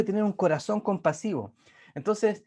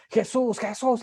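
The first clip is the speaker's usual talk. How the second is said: imitated shouting; 6 semitones higher, slower than usual. Preacher imitating a woman shouting Jesús at a protester. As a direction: call out the name like a confrontation. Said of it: "empieza a decir".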